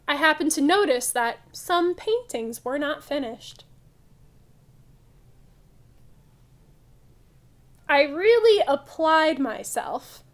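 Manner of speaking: loud, lively picture-book read-aloud; reading to children. Acted. Teacher reading a funny museum story to kids, loud lively read-aloud. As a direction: read it big and bright for the class.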